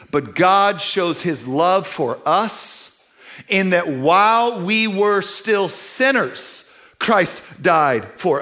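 slow, weighty reading; slow scripture reading. Acted. Preacher reading Romans to the church, slow and solemn. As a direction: give each phrase its own weight.

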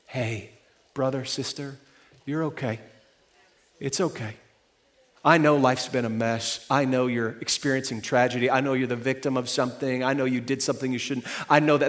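soft, low and gentle; tender reassurance. Acted. Pastor comforting a hurting listener, soft and tender. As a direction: hold them close with your voice.

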